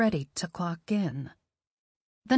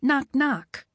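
knocking sound; 4 semitones higher, 3 dB louder than usual. Acted. Knocking at the door.

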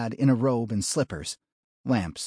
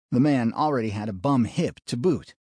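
plain reading voice

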